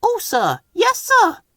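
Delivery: very high, tiny eager engine; peeping. A narrator's reading. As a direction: squeak it out keenly. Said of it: "peeped the little green engine".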